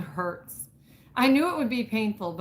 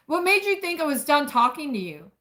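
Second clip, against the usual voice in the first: sharp and high, a hint of military bark; a touch of hot, clipped anger; edged angry demand; 6 semitones higher, 3 dB louder than usual. Man confronts the woman who walked out, sharp angry edge. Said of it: "There's an edge to his tone".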